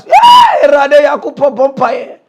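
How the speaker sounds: loud sudden yell, high start; loud shout of the brother; retold shout. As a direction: bark it out loud. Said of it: "Na there he shout".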